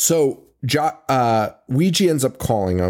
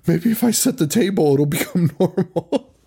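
put-on hopeful kid voice; 5 semitones higher than usual. Kid's hopeful thought voiced.

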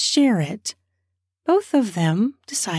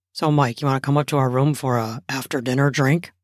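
quick, low male voice, inviting; husband voice; 7 semitones lower, faster than usual. Acted. Narrator voices husband Jasper.

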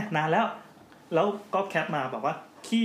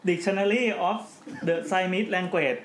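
mock English accent; 3 semitones higher, much slower than usual. Shown as a demonstration. Co-host asks for an accent, so he reads the title in a mock English accent. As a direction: put on an English accent for the dictionary title, half laughing. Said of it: "ตัวขอสำเนียงดิ".

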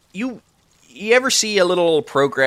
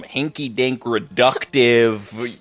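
slow and drawn out; much slower than usual. Acted. Drawn-out mocking of elderly fashion.

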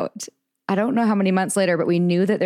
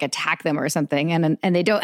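normal talking voice

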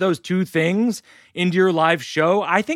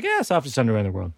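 lower, softer and fast, a quiet admission; convinced deadpan; 6 semitones lower, 3 dB quieter, faster than usual. Retold or imitated. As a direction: mutter it like he finally gets it. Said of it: "and I was like".

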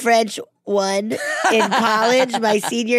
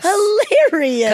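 delighted drawn out; 9 semitones higher, 4 dB louder, slower than usual. Real, not acted. Echoing with delight.

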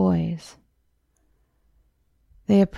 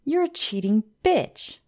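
higher, hurt offended jab; 8 semitones higher than usual. A narrator's reading. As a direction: spit it at the cheating friend. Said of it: "Mary sounded offended".

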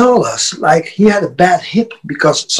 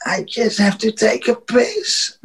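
imitated drunk; 4 semitones higher, 6 dB quieter than usual. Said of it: "but he was wasted".

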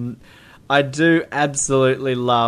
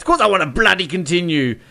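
mock angry Graves; 5 semitones higher, faster than usual. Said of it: "I do love angry Graves".

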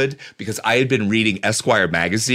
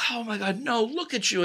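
high, hushed, doting; high, soft fussing; 14 semitones higher, 5 dB quieter than usual. Retold or imitated. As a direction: coo over him admiringly. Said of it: "they look at him like".

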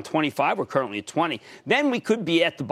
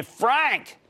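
calling out a name; 6 semitones higher than usual. Real, not acted. Calls the next caller on air.

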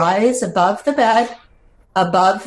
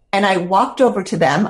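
usual speaking voice

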